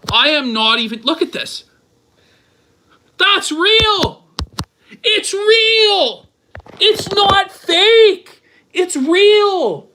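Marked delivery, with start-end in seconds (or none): 3.2-9.9 high, loud insistence; disbelieving yell. Real, not acted. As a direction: shout that it's real, stunned. Shouting in disbelief.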